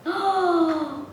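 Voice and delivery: long, high intake of breath; awed gasp. Real, not acted. Unboxer admires the new gift, awed gasp. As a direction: gasp in delighted wonder.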